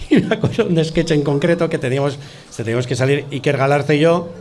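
usual speaking voice

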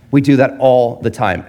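ordinary delivery

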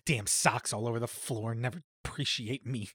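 low, soft grumble; mock grumbling to self. Host acts out talking to oneself, mock grumbling. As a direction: grumble privately about a messy housemate.

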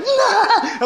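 high, laughing yelp of refusal; scared no. Retold or imitated. Scared little sister refusing her brother, high yelp.

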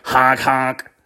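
squeezy horn honks; two mimicked honking noises; imitated honk. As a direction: honk like a little clown horn. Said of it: "He gave him a little honk".

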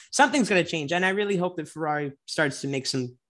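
normal talking voice

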